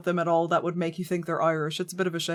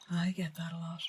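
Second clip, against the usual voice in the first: breathy aside, much softer, almost voiceless; whisper; 3 semitones lower, 13 dB quieter than usual. Real, not acted. Streamer whispers to chat Cerberus, a hushed aside. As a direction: mutter it under your breath like a secret. Repeats the line whispered to himself.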